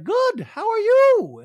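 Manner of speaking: very high, loud, drawn-out surprise; Dorit impression. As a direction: act shocked in a squeaky put-on voice. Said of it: "Dorit's like".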